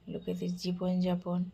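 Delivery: usual speaking voice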